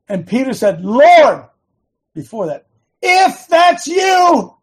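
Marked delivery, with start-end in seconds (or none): 0.8-1.5 loud, high cry to the Lord; calling out. Acted. Peter calling to the Lord, loud calling out. 3.0-4.6 high, loud, slow call across a distance; drawn-out shout. Acted. Peter calling to the Lord on the water, drawn-out shout.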